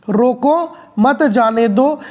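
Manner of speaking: रोको set apart by a pause, then मत जाने दो together; pause after रोको. Shown as a demonstration. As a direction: slowly, break after रोको. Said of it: "इस शब्द का मतलब कुछ दूसरा होता है". (रोको के बाद विराम, फिर मत जाने दो)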